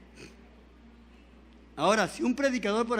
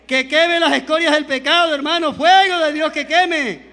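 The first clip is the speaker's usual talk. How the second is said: louder fervent preaching with slowed final cry; full-force preaching; 3 semitones higher, 7 dB louder than usual. Acted. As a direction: stir the church, calling for fire.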